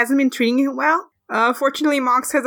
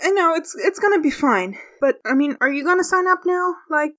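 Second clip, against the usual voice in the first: higher-pitched, hesitant nervous character; anxious Frog; 5 semitones higher than usual. Acted. Frog worries about the sign-up closing, nervous. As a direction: fret aloud in a high, uncertain voice.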